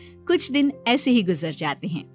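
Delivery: ordinary delivery, nothing marked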